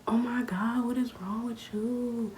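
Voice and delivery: put-on high shocked girls' voice, slowed; girls reacting in shock. The girls outside the car, shocked and high-pitched. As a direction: mimic the girls gasping at her. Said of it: "they're like".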